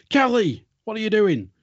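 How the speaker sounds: sharp high call; a boss catching a slacker, calling out high and loud; boss catching him out. Retold or imitated. A boss catches a worker, calls out high and loud.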